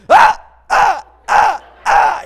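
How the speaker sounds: high, loud bursts of shrieking; imitated screams. Girl in the barrel roll, screaming in bursts. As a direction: scream in short, repeated bursts. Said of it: "you know, like this".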